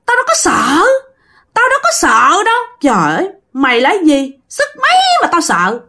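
high, jeering spirit voice; defiant possessed man. Acted. Possessed man dares the master, high and scornful. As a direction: scoff at the threat, pitched high. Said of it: "chú Tánh mới nhìn liếc".

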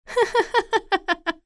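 high sinister doll cackle; evil doll laugh. Acted. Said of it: "وضحكت الدمية".

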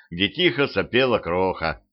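ordinary delivery, nothing marked

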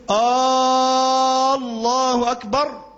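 "آه، الله" opens on a long drawn "آه" before "الله أكبر"; wrongly stretched hamza. Shown as a demonstration. Teacher shows students the forbidden long hamza, drawn out. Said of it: "مد هذه الهمزة وهو متعمد كفر".

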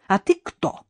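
high-pitched testy retort; snappish challenge. A narrator's reading. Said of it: "Он показал зубки". (огрызаясь)

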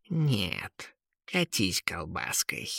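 low, slow, soft grumble; drowsy lazy brush-off; calmer grumpy cat. A narrator's reading. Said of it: "уже более спокойно ответил кот".

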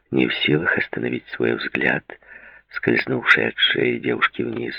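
ordinary delivery, nothing marked

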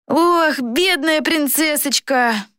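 high, drawn-out fake sympathy; mock pity. A narrator's reading. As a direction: coo with put-on pity, dragging it out. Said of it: "притворно покачал головой Флорин".

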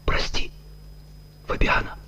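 soft whispered apology; mumbling. A narrator's reading. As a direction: murmur it to the dead wife. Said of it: "бормотал он".

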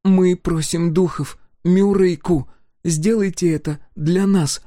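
put-on high, loud pleading child; pleading girl. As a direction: beg solemnly like a kid. Brin begs the spirits.

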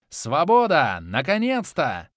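loud, slow relieved cry; joyful shouting. A narrator's reading. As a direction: shout out freedom at last. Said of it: "довольно прокричал Харашес".

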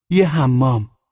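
put-on high, loud boy's voice; eager son. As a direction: call it out like an eager little boy. Son answers father in acted exchange.

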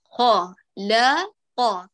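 خا heavy, لا thin, قا heavy, very slow; letter demo. Shown as a demonstration. Teacher drawing out خلق syllable by syllable. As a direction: stretch each syllable slowly. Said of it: "قا للأعلى".

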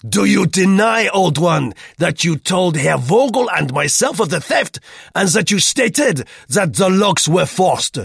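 loud, high-pitched interrogation; angry challenge. A narrator's reading. Roux challenges the old man's denial, angrily. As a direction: hurl the accusation at full voice. Roux confronts Duclos in quarrel.

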